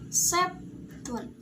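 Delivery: "septun" pitched high, then dropping on the last syllable; rise-then-fall intonation. Shown as a demonstration. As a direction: demonstrate "septun" going up, then down. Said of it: "naik, turun".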